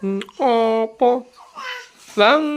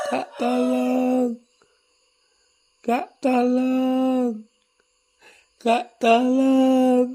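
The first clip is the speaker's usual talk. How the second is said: slow model request; 5 semitones higher, much slower than usual. Shown as a demonstration. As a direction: stretch out a slow, gentle tolong, Kak. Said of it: "Minta tolong kakaknya".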